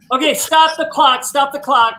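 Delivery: loud, high plea to halt the game; urgent call. Real, not acted. Player halts the timed game.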